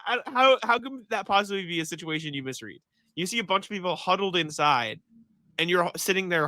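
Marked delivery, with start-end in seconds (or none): 0.1-2.8 mock disbelief. Incredulous retold reaction, high pitch.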